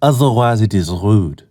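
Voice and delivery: hammed-up French accent, haughty; obnoxious French accent. Homeless man scolding a tourist, obnoxious French accent. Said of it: "in the most obnoxious French accent".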